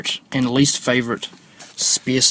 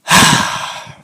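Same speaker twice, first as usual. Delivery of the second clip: exasperated sigh; 6 dB louder than usual. Real, not acted. Exasperated after retold accusation.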